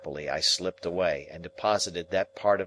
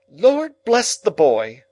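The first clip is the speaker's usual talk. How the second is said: much higher, louder, slow exclaim; exclamation; 10 semitones higher, 11 dB louder, slower than usual. Acted. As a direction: throw up your hands and exclaim. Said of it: "exclaimed my sister".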